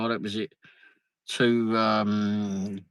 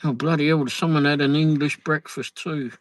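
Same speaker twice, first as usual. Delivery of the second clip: ordinary talk, nothing marked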